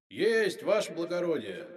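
high, distant, echoing shout; faint, jubilant far-off cry; triumphant from underground. A narrator's reading. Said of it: "донёсся из-под земли торжествующий голос".

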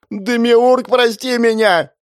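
high, loud begging cry; desperate pleading. Acted. Hero begs the god.

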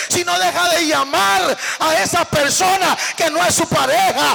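loud, high fiery sermon; fiery preaching to radio and TV listeners; preaching at full force. Acted. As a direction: thunder it at the listeners. Preacher addresses radio audience.

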